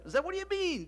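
high-pitched disbelieving question; incredulous. Acted. Boy questioning Zeb's report, incredulous. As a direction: challenge a friend's story, pitch jumping up.

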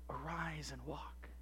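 low, slow, very soft; hushed command. Acted. Jesus telling the paralytic to rise, hushed. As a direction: command gently with quiet authority.

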